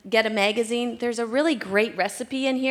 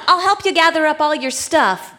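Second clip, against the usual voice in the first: normal talking voice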